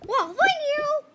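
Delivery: high delighted squeal; excited. Pretend. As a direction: squeal with joy. Delighted seller.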